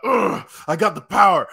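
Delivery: lightly hammed-up power boast; powered-up hero. Imitates powered-up Koda posing.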